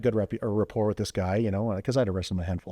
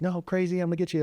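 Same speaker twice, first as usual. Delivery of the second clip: high, raised call after a runner; calling after him; 10 semitones higher than usual. Retold or imitated. Officer shouting after a fleeing suspect, high raised call. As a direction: yell it after him as he bolts.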